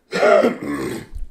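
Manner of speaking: harsh chesty cough; a real hacking cough; loud cough. Real, not acted. Sick streamer coughing.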